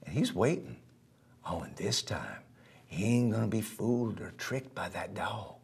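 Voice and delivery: low, hushed whisper of a lurking threat; storyteller hushing kids while the gator lurks; suspense whisper. A narrator's reading. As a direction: lean in and whisper the danger.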